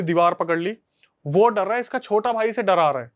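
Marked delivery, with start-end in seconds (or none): none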